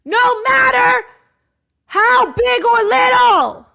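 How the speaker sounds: high, loud and slow shout; booming all caps. Retold or imitated. Shouting the diary's all-caps line at viewers. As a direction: declare it like a battle vow. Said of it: "All caps".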